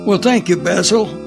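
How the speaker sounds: ordinary talk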